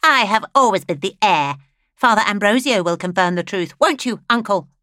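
a grasping widow demanding agreement; haughty widow. Acted. Flaminea demands confirmation.

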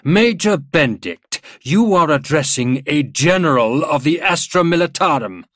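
high, loud, pompous official voice; officious. A narrator's reading. General pulling rank on the major, officious. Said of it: "Gruber put on his officious voice".

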